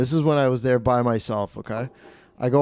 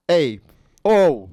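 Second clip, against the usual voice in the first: brash Italian-American gambler, pitched up; drawn-out mock telling-off, Italian-American style; Stefano; 4 semitones higher, slower than usual. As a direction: imitate a husband brushing off his wife. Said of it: "This is exactly how he talked".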